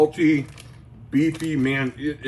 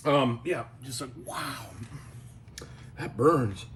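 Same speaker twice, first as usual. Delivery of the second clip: strained quiet from the burn; 10 dB quieter than usual. Real, not acted. Mouth burning from hot jerky.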